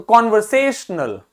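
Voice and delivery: Conversational with से drawn out and stressed. Shown as a demonstration. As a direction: lean on the से in conversational. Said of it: "से आपको अलग से सुनाई".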